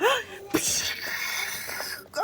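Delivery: breathy shocked gasp; whispered gasp. Real, not acted. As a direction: gasp in surprise. Narrator gasps at spill.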